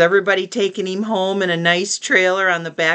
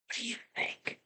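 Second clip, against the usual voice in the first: hushed whisper to the listener; a storyteller's suspense whisper; 21 dB quieter, much faster than usual. Real, not acted. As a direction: whisper it like a secret. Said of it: "I wonder what's gonna happen".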